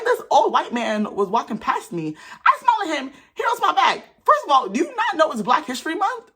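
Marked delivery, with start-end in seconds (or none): 2.5-6.3 high-pitched indignant squeal; indignant rant. Retold or imitated. Telling viewers about the man who didn't smile back, indignant. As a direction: voice shooting up in outraged disbelief.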